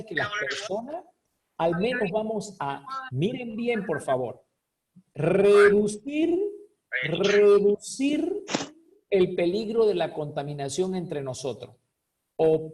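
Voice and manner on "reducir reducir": REDUCIR drawn out slowly, louder and higher; emphatic repetition. Real, not acted. Teacher asking the class to look closely, REDUCIR slow and emphatic. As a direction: stretch REDUCIR out loud, ending on a rise. Teacher drives home the key word.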